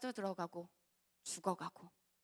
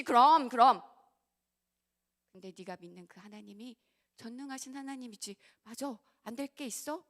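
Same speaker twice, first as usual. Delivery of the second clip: coaxing devil voice; 4 semitones higher, 16 dB louder than usual. Retold or imitated. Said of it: "마귀가 그럴 거예요".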